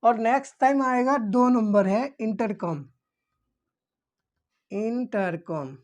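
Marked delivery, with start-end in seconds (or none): none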